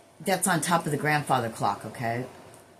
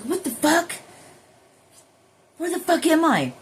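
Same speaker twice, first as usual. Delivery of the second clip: much higher, strained mock disbelief; imitating shock; 11 semitones higher, 5 dB louder than usual. Imitating her own startled self coming back to her body, mock shocked. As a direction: blurt it like waking somewhere strange. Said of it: "And you're like".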